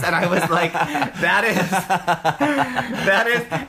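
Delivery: high, laughing through the words; cracking up mid-story. Real, not acted.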